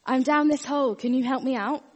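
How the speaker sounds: high, loud call up to the priest; shouting for help. Retold or imitated. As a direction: holler up out of the hole. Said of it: "and the guy shouts out".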